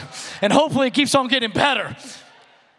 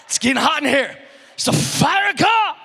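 fiery preacher voice; 5 semitones higher than usual. Shown as a demonstration. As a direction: whip up the crowd like a revival preacher. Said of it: "even when I preach, my voice would, like, completely change".

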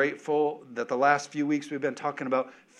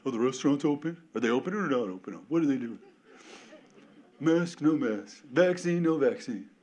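mocking chatter. Mocking distracted churchgoers.

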